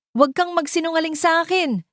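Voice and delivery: high, loud furious shout; furious yell of betrayal. Acted. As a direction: scream the accusation at her. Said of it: "sigaw ni Ara".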